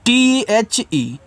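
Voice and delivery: letters spelled out one by one, very slowly; slow letter-by-letter spelling. Shown as a demonstration. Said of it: "इसको पढ़ते हैं क्या".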